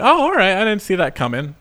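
light retold surprise; mild mock surprise. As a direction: pleasantly caught off guard, retold. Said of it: "but also like".

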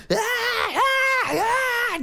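high mock panicked yelling ah; imitated screaming. Manager jumping on bed, high mock screaming. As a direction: scream like a man gone mad. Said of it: "Paul Curtis is just going".

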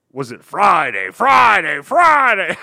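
loud, high hyped-up announcer shout; monster truck ad. Retold or imitated. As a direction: yell it like a monster truck promo. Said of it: "the monster truck voice".

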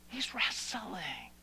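slow hushed whisper. Real, not acted. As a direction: lean in and breathe it softly. Preacher drops to hushed aside.

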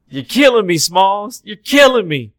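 loud, high-pitched yelling; hammed-up exasperated shout; exasperated movie quote. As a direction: holler it like a fed-up kid on a ball field. Acting out the Sandlot movie line.

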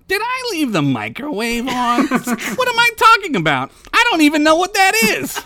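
put-on high, fast, loud dim kid voice; high panicky kid voice. Dim kid's inner panic.

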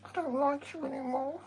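squeaky high sulky dog voice; dog's voice. Pretend. Voicing the dog.

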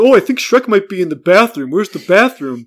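mock dopey normie; dopey tourist. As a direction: play a lost clueless guest. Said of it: "it's like".

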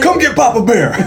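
high, playful mock holler; hammed-up movie holler. Co-host quotes a favorite movie, hammed-up holler. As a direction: call it out big, laughing along.

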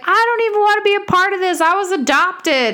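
high, rushed whiny voice; whiny complaint. Retold or imitated. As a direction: whine it like a sulky kid. Eddie's whiny retold complaint.

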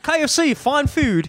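high, put-on Aussie-sounding British cop; a cop sliding into Australian; mock Australian accent. The cop answers in a put-on Australian-sounding accent. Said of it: "I'm doing so Australian".

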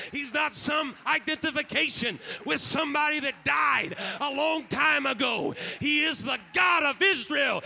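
loud, rising proclamation; full force preaching. Acted. Pastor declaring God is no mirage, loud and rising. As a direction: shout it with conviction.

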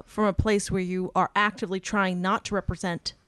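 usual speaking voice

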